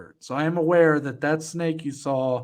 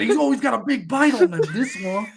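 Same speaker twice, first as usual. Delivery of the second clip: put-on fast, high, over-the-top Steve Irwin-style Australian accent; bad Australian accent; 7 semitones higher, 3 dB louder than usual. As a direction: crank it up higher and faster like an excited croc hunter. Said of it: "This is the worst Australian accent ever".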